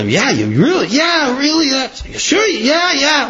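high, hesitant mother's voice; doubtful mother. Retold or imitated. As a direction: play the mother giving in while still unsure. Mother reluctantly asks "you sure?.

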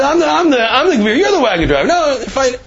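high-pitched, heated insisting; high insistent. Retold or imitated. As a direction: argue it back, high. Insisting quarrel.

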